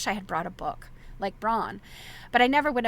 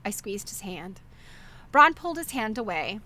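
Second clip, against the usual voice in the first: ordinary delivery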